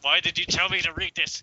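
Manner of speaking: protest, half laughing, at the awful text; exasperated outburst. Real, not acted. Reader protests to friend about the embarrassing story, exasperated.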